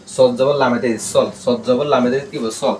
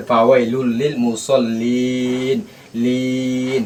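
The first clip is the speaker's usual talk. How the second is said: very slow, stretching the final মুসল্লিন into a long ন; drawn-out recitation; much slower than usual. Shown as a demonstration. Teacher shows students the long stop at a waqf, recited very slowly. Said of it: "মানে আপনার তিন লিপ টানবো".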